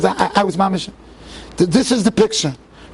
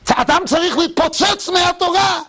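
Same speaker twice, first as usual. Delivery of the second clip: higher and fast, bursting cry; fervent outburst; 8 semitones higher, faster than usual. Real, not acted. Preacher urges his listeners, fervent higher burst. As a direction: let it explode out of you.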